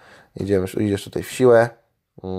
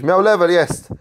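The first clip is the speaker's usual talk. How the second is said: excited; 8 semitones higher, 6 dB louder than usual. Real, not acted. Unit finally levels up.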